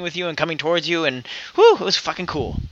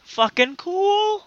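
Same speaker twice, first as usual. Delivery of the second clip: drawn-out awe; 13 semitones higher, much slower than usual. Real, not acted. Drawn out enthusiastic praise.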